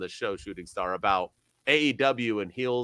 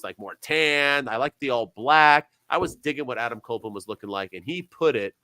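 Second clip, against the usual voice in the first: usual speaking voice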